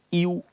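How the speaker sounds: "E U" read out as the letter names, ee then oo; wrong beginner pronunciation. Shown as a demonstration. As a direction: say "E U" as two separate letters, the beginner's mistake.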